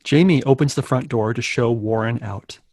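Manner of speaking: ordinary talk, nothing marked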